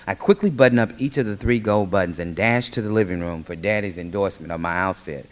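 usual speaking voice

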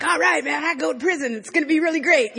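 high, eager pep talk to herself; hyped-up bravado; gung-ho mock enthusiasm. Acting out her naive eagerness.

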